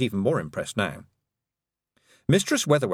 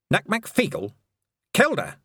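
sharp, high demand; 11 semitones higher than usual. Acted. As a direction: insist on an answer. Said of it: "she demanded".

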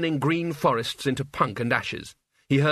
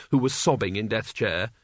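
ordinary delivery

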